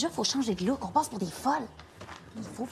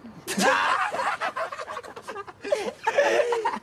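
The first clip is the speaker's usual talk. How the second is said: mocking laughter; 13 semitones higher than usual. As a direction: laugh right in her face at the Quebec accent. Mocking laugh at her accent.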